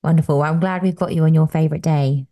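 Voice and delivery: ordinary talk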